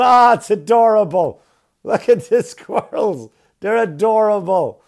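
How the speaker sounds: high, loud, drawn-out cooing; mock gushing delight. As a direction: gush like a delighted onlooker, loudly. Mimicking onlookers gushing over squirrels.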